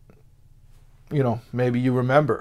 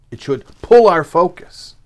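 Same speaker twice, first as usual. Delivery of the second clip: slow, louder, higher stress on every word; emphatic; 6 semitones higher, 12 dB louder than usual. Real, not acted. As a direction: drive the point home slowly and firmly. Preacher drives the point home.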